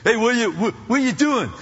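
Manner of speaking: imitated East Coast greeting; stammered East Coast street hello; East Coast accent. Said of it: "must be from the East Coast".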